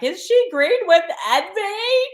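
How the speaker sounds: high, loud mock-worried question; mock alarm. Voicing worry over a scary-looking card, mock alarm.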